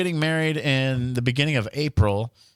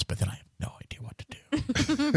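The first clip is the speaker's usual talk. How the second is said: breathy near-whisper, higher; 12 semitones higher, 6 dB quieter than usual. Real, not acted. Husband whispering that he has no idea what comes next. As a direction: let it drop to a helpless whisper. Joking helplessness, trailing off.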